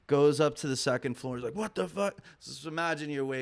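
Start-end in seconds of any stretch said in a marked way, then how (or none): 1.6-2.2 high-pitched, retold what-the-hell reaction; higher voice of baffled surprise; mock disbelief. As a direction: imitate a startled crackhead, pitched up without shouting. Intruder climbs to loft, reacts.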